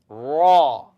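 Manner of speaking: raw said loud with a full open a; teacher demo. Shown as a demonstration. Teacher calls out raw for students to repeat. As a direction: model raw loudly for the class. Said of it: "It's like what a lion says".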